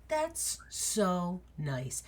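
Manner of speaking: huge wolf softly thanking a tiny guest; gentle giant. Acted. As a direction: lean down and speak tenderly. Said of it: "is like".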